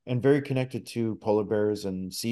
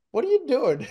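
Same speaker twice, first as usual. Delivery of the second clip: much higher, louder scolding inner voice; the voice in his head catching him out; mocking inner voice; 12 semitones higher, 4 dB louder than usual. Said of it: "this voice in, in my head goes".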